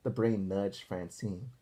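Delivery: ordinary delivery, nothing marked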